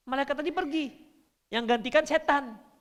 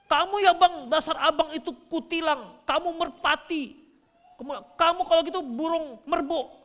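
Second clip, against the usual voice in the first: mock shrieking wife, high and loud; preacher hamming up a shouting wife; shrill nagging wife; 8 semitones higher, 5 dB louder than usual. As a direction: play a wife screaming at her husband. Wife yelling insults at husband.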